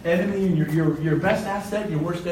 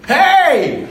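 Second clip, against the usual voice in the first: startled shout; 8 semitones higher, 8 dB louder than usual. Retold or imitated. Startled calling back at driver.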